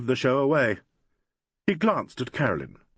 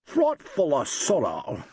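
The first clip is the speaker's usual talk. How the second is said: failed English imitation; 3 semitones higher, much slower than usual. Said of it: "I can't seem to get it".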